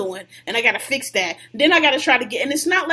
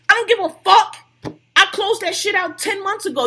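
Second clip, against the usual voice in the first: angry outburst; 6 semitones higher, 4 dB louder than usual. Real, not acted. Ranting about track changes at work.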